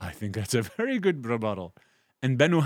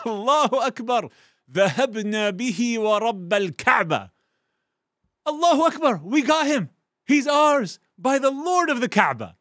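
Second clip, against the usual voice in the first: much higher, louder triumphant shouting; joyful loud cry; 12 semitones higher, 7 dB louder than usual. Acted. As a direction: cheer it out like a victorious crowd. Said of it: "very loudly said".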